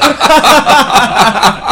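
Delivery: big high cackling laugh; loud burst of laughter. Real, not acted. Recalling [name] in the crowd.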